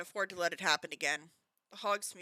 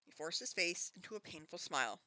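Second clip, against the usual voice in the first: usual speaking voice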